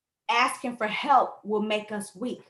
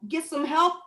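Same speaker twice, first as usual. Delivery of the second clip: ordinary talk, nothing marked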